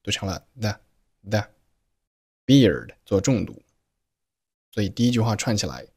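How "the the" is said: "the" as that with its T held silent; slow "the", that cut off before the T burst; swallowed final T. Shown as a demonstration. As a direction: swallow the T, leaving "the". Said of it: "但是把声音给吞进去".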